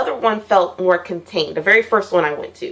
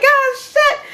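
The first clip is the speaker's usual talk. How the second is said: much higher, alarmed blurt; startled high yelp. Retold or imitated. Retelling the monster hitting back, high startled yelp.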